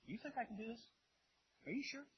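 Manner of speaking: high-pitched, doubting question; probing question. Acted. Jesus tests the two blind men, doubtful. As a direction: query it with a high lifted voice.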